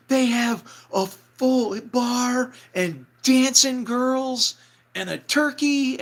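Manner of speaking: high, loud and drawn out, word by word; amazed excited shout. Retold or imitated. Jason charging into dressing room with news, amazed shout. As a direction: burst in yelling astonishing news.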